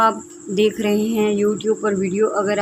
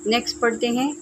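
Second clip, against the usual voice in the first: ordinary talk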